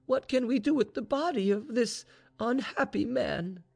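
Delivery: high-pitched anguished question; distressed cry. A narrator's reading. Mistress despairs over lover's body, distressed cry. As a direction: plead helplessly for an answer. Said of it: "cried Vaninka".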